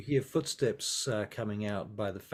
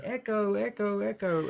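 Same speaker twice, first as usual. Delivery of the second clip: echoing call; 11 semitones higher, 4 dB louder than usual. Acted. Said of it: "And she's going".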